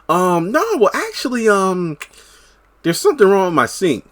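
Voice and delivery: a hint of a coy neighbor stalling him; flirty neighbor. Retelling flirty neighbor Deja.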